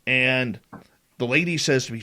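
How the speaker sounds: ordinary talk, nothing marked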